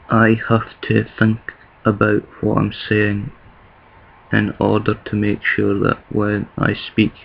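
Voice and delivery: slow, deliberate proper English, each word placed; slow careful English. Shown as a demonstration. Scotsman carefully speaking proper English to viewers, slow and deliberate. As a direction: think about every word as you say it slowly. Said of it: "like I will do now".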